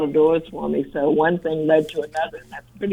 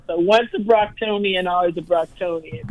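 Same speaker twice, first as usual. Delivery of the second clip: ordinary delivery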